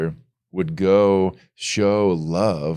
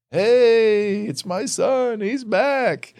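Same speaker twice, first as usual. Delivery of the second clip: high, delighted cry of a welcoming father; joyful father; 14 semitones higher, much slower than usual. Retold or imitated. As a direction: call it out high, overjoyed the son is home. Father welcomes prodigal son home.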